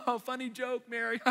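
high-pitched mock laughing along; frustrated Martha. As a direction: fake amusement at your sister's joke, pitched high. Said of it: "starts to get a little frustrated with Mary".

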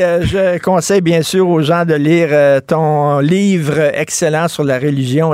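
ordinary talk, nothing marked

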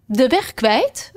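slow, high disbelieving question; incredulous echo. Acted. Red queen echoes Alice's words, slow and incredulous. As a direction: repeat it as if absurd.

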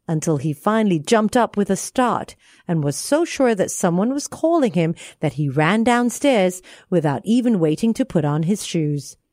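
ordinary delivery

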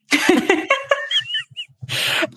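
a burst of real high-pitched laughter; real laugh breaking out at a husband's joke. Real, not acted.

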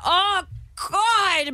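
a hint of panicked groan; mock panic. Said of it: "moment when you remember".

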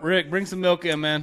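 loud call across the room. Real, not acted. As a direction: shout for Rick to bring milk. Calling to Rick offstage.

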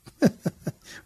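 short real chuckle; breathy laugh. Real, not acted. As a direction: laugh at the joke. Joke about getting old.

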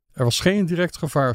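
usual speaking voice

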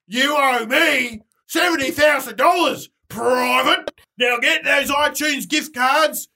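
loud drill-sergeant shouting; barking army general. Acted. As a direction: bark orders at a private. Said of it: "I'm the general from the army".

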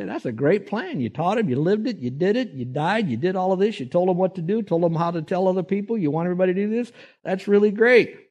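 lightly voiced wag of an angel, poking at the plan; cheeky angel. Wag angel questions Jesus's plan.

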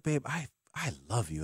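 ordinary talk, nothing marked

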